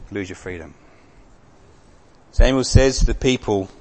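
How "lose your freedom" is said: the phrase repeated much softer and lower; quiet echo. Shown as a demonstration. Preacher echoing the warning to the congregation, hushed. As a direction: let it fall away to almost nothing. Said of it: "You will lose your freedom".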